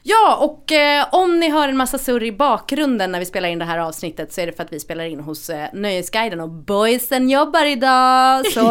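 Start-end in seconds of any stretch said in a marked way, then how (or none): none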